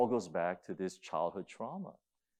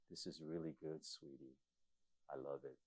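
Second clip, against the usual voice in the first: tender Jesus; 7 semitones lower, 16 dB quieter than usual. Retold or imitated. Jesus speaking tenderly to girl.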